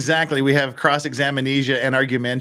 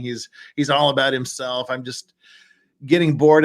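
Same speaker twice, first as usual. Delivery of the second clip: ordinary delivery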